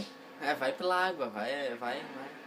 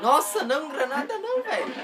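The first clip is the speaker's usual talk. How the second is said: high, loud laughing yell at a grenade; excited shout; 11 semitones higher, 7 dB louder than usual. Real, not acted. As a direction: cry out in laughing alarm. Granada explode no jogo. (grito empolgado rindo)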